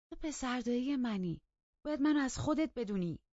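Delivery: high, urgent pleading argument; insistent appeal, loud at its peak; stubborn insistence. A narrator's reading. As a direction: refuse to give up, pitched high and pressing. Stubborn cousin insists on being heard.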